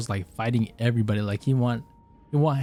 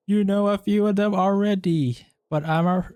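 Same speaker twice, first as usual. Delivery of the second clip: high put-on woman's voice; failed girl voice; 7 semitones higher than usual. Streamer trying to voice Jean for chat, failed girl voice. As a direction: push it up high, badly girlish. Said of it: "I cannot do a girl voice for shit".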